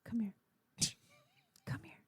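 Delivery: a parent's hushed scary warning; menacing quiet threat. Retold or imitated. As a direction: threaten them calmly under your breath. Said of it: "Finally, I would just go".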